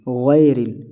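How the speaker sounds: "غیرل" as ghae-ril, open e; majhool vowel. Shown as a demonstration. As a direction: loosen the first syllable of "غیرل" to ghae. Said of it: "یہ غیرل نہیں". ("غیرل" غے کے ساتھ)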